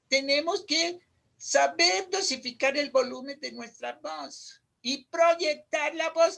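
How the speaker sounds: normal talking voice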